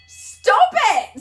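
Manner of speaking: high, loud "stop it"; laughing protest. Real, not acted. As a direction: squeal at the friend to stop. Telling off the joking friend.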